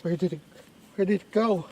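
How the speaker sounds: loud, high panicked questions; frantic. Acted. Frantic searching.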